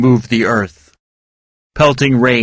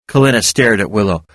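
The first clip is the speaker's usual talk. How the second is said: usual speaking voice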